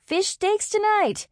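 high, slow triumphant crowing; drawn-out jubilant call; excited cry. A narrator's reading. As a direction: crow it out in delight. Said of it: "Ray cried as she fought it".

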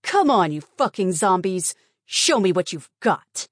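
loud, furious battle yell; defiant scream. Acted. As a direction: scream the challenge at them. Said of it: "screamed with defiance".

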